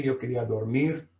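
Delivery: ordinary talk, nothing marked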